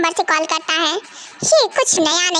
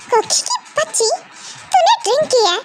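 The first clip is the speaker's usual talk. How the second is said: high, scolding mother's cry; 6 semitones higher than usual. Acted. Mother scolds her drunk daughter, high and sharp. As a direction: tell her off like a cross mum.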